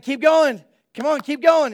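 loud, high, frantic push forward; desperate father urges Jesus through the crowd, loud and frantic; urgent urging. Retold or imitated. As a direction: hurry them on through the crowd. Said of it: "he's like".

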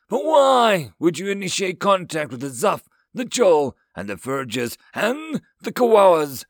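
high, loud, outraged alien yell. A narrator's reading. Alien counselor listing the forbidden species, yelling in outrage. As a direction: yell the list, hitting each name. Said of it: "he yelled".

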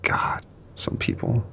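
unvoiced whispered grumble; whispered aside. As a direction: whisper it under your breath. Worker mutters insult aside.

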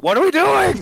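high-pitched exasperated outburst; Jim Harbaugh. Acted. Coach watching the game on TV, mock exasperated outburst. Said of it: "you know he's like".

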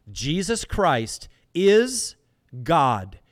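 slow and loud, hang on "is" then land hard on "God"; emphatic declaration. Real, not acted. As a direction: drag out "Jesus Christ is" and drop "God" like a hammer. Preacher stressing core doctrine.

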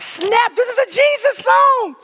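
high-pitched, thrilled exclamation; excited outburst. Retold or imitated. Preacher recounting discovering a Jesus song, excited outburst. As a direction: burst out high with sudden delight.

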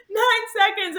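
high, stunned, loud; disbelief. Retold or imitated. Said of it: "And I was like".

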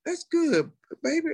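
high, placating mom voice; imitating unsure mom. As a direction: humor them like a puzzled mother. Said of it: "didn't probably know how to respond".

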